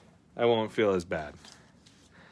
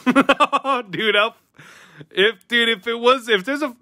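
laughing; 10 semitones higher, 4 dB louder than usual. Real, not acted. Laughing at a pack result.

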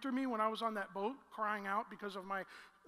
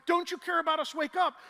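loud, higher demand to wake; urgent plea; 6 semitones higher, 8 dB louder than usual. Retold or imitated. Disciples to sleeping Jesus, loud urgent plea. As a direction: shake him awake with your voice.

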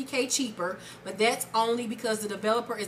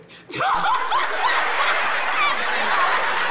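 high, breathless cackling laugh; shrieking laugh; 6 dB louder than usual. Real, not acted. Laughing at her own joke about the stepdad, high shrieking cackle. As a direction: crack up hard.

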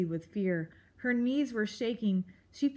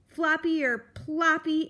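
louder, cranky bellow; bellowing porcupine; 6 semitones higher, 5 dB louder than usual. A narrator's reading. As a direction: bellow at the mouse. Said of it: "Aerith bellowed".

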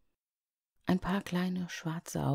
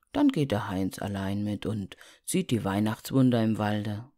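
deep snowman voice; 6 semitones lower than usual. Acted. Schneemann spricht weiter.

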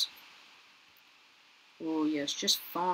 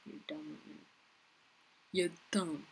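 muttered aside; 9 dB quieter than usual. Real, not acted. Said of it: "I was talking to myself".

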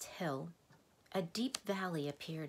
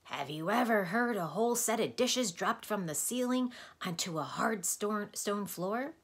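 raspy, higher and louder questioning stranger; a raspy stranger calling from a dark wagon; hoarse voice; 5 semitones higher, 5 dB louder than usual. Acted. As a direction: croak it out like an odd doctor. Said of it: "a hoarse voice inquired".

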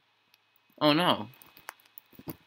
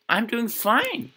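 much higher, slow strained voice; squeaky fake calm; 10 semitones higher, slower than usual. Real, not acted. Gamer lost in the dark insisting he's fine, squeaky. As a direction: pretend all is fine, voice squeaking.

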